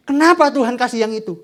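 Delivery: whining. Acted. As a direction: whine it out like a sulky child. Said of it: "kita merengek-rengek".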